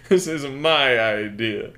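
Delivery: slow, loud protest through laughter; laughing protest. Real, not acted. Storyteller defends the pitch to a teasing cohost, laughing protest. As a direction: stake your claim loudly, laughing.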